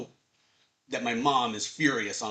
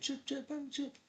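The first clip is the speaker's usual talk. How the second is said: distant call; 12 semitones higher, 10 dB quieter than usual. Retold or imitated. Distant voice calling Chip.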